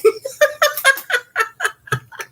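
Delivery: high pleased laugh; laughing delight. Real, not acted. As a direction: laugh it off, tickled. Laughs after I try.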